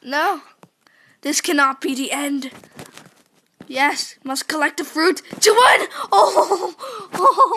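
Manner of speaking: loud, high desperate cries; frantic shouting. Real, not acted. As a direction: shout in panic to win. Nearly losing the race, shouting.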